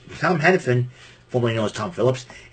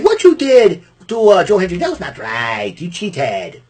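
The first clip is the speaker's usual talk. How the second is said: mock Santino scolding; 5 semitones higher, 4 dB louder than usual. Imitating Santino scolding Bully Ray.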